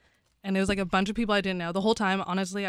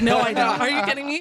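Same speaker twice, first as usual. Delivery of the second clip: high, louder protest; incredulous denial; 5 semitones higher, 5 dB louder than usual. Retold or imitated. Comedian denying to fans she does stand-up, incredulous. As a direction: protest in high-pitched disbelief.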